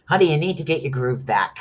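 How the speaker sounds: ordinary delivery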